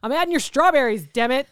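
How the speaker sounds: high, loud mock snapping; mock annoyed vendor. Funnel cake vendor snapping at a customer, loud mock annoyance. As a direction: snap at the customer for fun. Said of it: "I'd be like".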